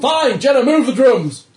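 high, very loud command; officer bellowing across the deck; exasperated shouted order. Acted. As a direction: yell at the crewmate to get moving. Officer shouts order at player's character.

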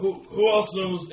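loud slow urgent question; urgent demand. Acted. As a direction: press hard for answers. Ogre player demands answers.

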